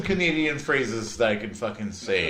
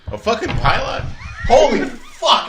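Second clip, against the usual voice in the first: much higher, loud laughing yell of realisation; laughing shout; 13 semitones higher, 11 dB louder than usual. Real, not acted. Realising pilar means pylon, shouting with laughter. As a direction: burst out as it finally clicks.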